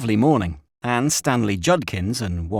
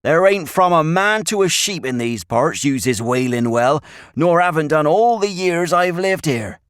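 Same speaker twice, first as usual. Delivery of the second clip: loud, higher rustic voice; old country shepherd; 8 semitones higher, 5 dB louder than usual. A narrator's reading. Shepherd telling the boys nobody uses the well, emphatic rustic. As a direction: tell them firmly in country speech.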